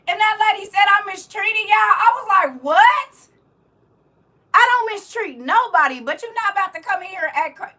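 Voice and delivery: high, loud incredulous retort; worked-up, high-pitched indignation; indignant protest. Real, not acted. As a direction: push back loudly at an accusation. Retells accusation with indignant reaction.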